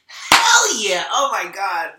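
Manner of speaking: high, loud whoop of triumph; excited shout. Real, not acted. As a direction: yell it out in joy at the card. Card draw confirms her message.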